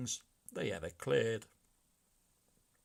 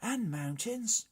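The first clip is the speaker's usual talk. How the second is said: much higher squeaky brigand chorus; brigands' voice; 11 semitones higher, 4 dB louder, slower than usual. A narrator's reading. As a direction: chime in, high. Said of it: "added the brigands".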